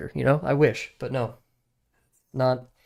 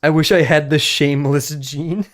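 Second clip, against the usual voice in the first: loud; 6 dB louder than usual. Real, not acted. Loud, slow declaration.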